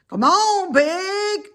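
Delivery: high, loud call to the pig; farmhand calling. A narrator's reading. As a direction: shout across the yard to a stubborn pig. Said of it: "said Lurvy".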